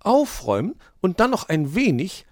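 high, indignant magpie scolding; ill-humoured. A narrator's reading. Magpie rejects the fox's little tidy-up, ill-humoured. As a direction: object crossly, starting loud. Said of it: "erwiderte die Elster missgestimmt".

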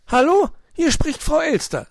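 high, fussing magpie voice; a worried bird asking at the table. Acted. Frau Elster fragt Onkel Uhu besorgt, hohe Elsternstimme.

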